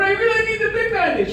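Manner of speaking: high pitched mock child's wail on really; whiny little girl. Daughter insisting to dad on the big Band-Aid, high mock whine. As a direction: imitate the kid, pleading high.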